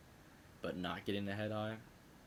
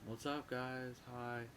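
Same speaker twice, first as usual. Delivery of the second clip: imitating a casual greeting, pitched up; fake-casual hello, trying to seem sober; high, put-on nonchalance; playing it cool; 4 semitones higher, 3 dB quieter, slower than usual. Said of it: "played it off as cool as I possibly could".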